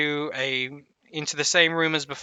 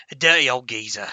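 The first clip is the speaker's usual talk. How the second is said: put-on a touch of cockney, dirty old geezer; hint of a cockney old man; 6 dB louder, faster than usual. Shown as a demonstration. GM tries out the half-orc's cockney voice. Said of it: "with a bit of a cockney".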